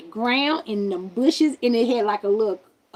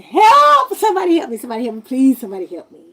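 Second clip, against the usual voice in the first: loud high cries for help, fading; screaming for help; 3 semitones higher, 11 dB louder, faster than usual. Retold or imitated. Woman running down the road, loud high cries for help. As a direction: yell for help as you run. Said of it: "I start screaming".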